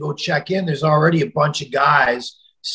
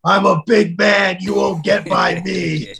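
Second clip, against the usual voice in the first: threatening caller; 5 semitones higher than usual. Retold or imitated. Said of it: "And he goes".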